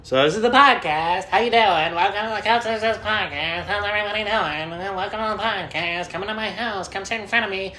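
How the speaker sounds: hammed-up high, cheery host welcome; mock podcast host. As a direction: greet the listeners like a cheesy show host.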